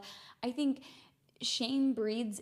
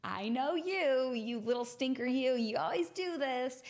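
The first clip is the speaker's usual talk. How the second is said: louder, mock scolding with playful affection; playful self-teasing; 3 semitones higher, 3 dB louder than usual. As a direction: tease yourself like a naughty little rascal. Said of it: "I'd start laughing at myself".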